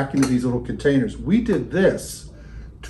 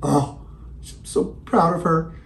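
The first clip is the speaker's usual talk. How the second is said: talking to a plant; 4 semitones higher, slower than usual. Real, not acted.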